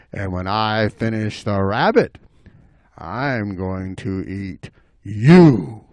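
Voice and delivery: low, measured threat; menacing bobcat. Acted. Bobcat threatening the squirrel, low slow menace. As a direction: drop low and stretch it out. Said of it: "and he said".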